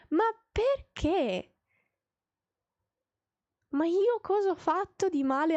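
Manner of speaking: Italian exasperation, long and pained; exasperated cry. Real, not acted. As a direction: plead with the universe. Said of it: "we're having an Italian moment".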